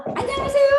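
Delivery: high, beaming hello; bright cheerful greeting. Shown as a demonstration. 반가운 손님에게 인사하듯 높고 밝게 맞이하기. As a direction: greet them like you're thrilled to see them. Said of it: "이렇게 하고 싶은데".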